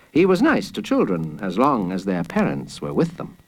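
plain reading voice

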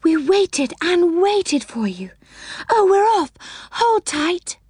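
high, excited exclaiming; excited cry. A narrator's reading. Fairy friend warns riders the ride is moving, excited cry. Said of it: "cried Silky".

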